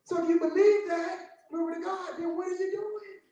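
high, ringing preacher's cry at its peak; preaching at full force. Acted. Preacher comforts the grieving church, in a high crying shout. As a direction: lift the church up to the promise of God. Preacher's climax about the dead.

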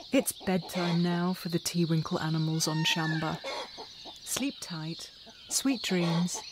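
soft, gentle goodnight; bedtime sign-off. A narrator's reading. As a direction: lull the little ones to sleep.

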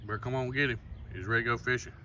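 usual speaking voice

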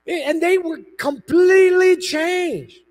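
slow, loud, high proclamation; preacher shouting the climax, slow; preaching shout. Real, not acted. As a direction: proclaim it to the whole room.

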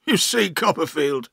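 downcast character voice; mildly theatrical misery; miserable. Acted. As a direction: begin a woeful confession. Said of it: "he said miserably".

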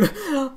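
a short, soft laughing sob; a quiet wet laugh through tears; tearful laugh. Real, not acted. Gamer overwhelmed by his full combo, a soft tearful laugh. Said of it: "there are tears coming out".

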